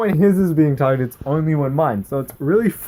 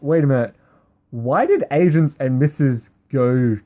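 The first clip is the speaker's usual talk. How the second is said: ordinary talk, nothing marked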